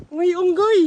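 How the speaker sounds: high excited call. Real, not acted. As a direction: point it out with a thrill. Spotting a monkey excitedly.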